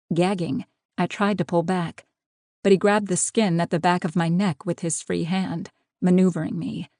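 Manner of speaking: ordinary delivery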